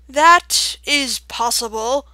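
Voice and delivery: loud, very slow owl; deep, round voice. A narrator's reading. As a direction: concede it ponderously, in a sonorous voice. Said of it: "deep, round voice".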